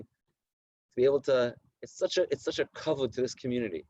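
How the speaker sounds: usual speaking voice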